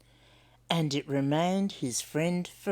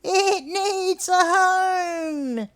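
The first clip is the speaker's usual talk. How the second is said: much higher, louder, very slow cockatoo; drawn-out squawky-bird delivery; 13 semitones higher, 6 dB louder, much slower than usual. Acted. Cockatoo speaks up.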